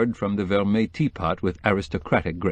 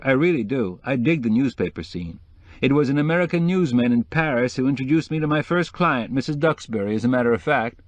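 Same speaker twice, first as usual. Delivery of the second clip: higher-pitched, sociable decorator voice; chatty decorator; 6 semitones higher than usual. Acted. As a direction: play the eager, name-dropping decorator.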